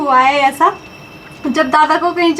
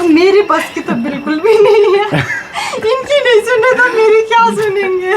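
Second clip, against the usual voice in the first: laughing; 5 semitones higher than usual. Retold or imitated. Retold remark, laughing.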